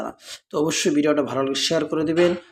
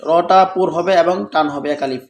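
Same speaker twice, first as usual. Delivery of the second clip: ordinary talk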